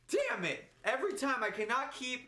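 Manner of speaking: high-pitched exasperated venting; pissed off. Real, not acted. Podcaster annoyed at own name mix-up, high frustrated venting. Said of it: "I'm so pissed off right now".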